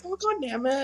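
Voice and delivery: very soft dejected grumble; sad. Acted. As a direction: swear quietly under your disappointment. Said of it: "And he's all sad".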